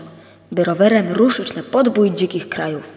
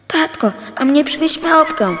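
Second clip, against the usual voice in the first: put-on higher, child-like begging voice; a little daughter's bright plea for a monkey; little daughter asking; 9 semitones higher, 3 dB louder than usual. A narrator's reading. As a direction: ask sweetly like a small girl. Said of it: "prosiła córeczka".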